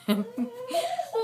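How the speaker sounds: high-pitched laughing burst. Real, not acted. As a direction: break into giggles. Interrupted by child, cracks up.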